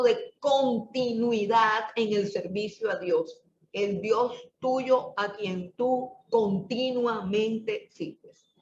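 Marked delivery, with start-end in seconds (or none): 3.7-8.6 slow, weighty recital of the verse; solemn emphasis. Shown as a demonstration. Preacher closing on the key verse, slow and solemn. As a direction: savor each word slowly. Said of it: "Continuamente es la palabra clave".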